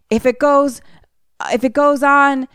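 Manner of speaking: high, urgent mock yell; acted frantic warning; retold yelling. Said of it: "he just is yelling".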